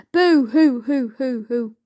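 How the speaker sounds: hammed-up fake sobbing; mock boo-hoo crying. A narrator's reading. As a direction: wail a storybook boo-hoo. Mock weeping written in story.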